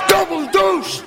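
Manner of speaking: high, shouted triumph; jubilant shout. Acted. Gambler sees the kid hit double deuce, jubilant shout. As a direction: yell it out at the winning roll. The throw wins.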